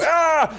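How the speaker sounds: high, loud mock kung-fu cry; mock karate yell. Friend retelling the kick to mock him: high, loud mock karate yell. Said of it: "you go".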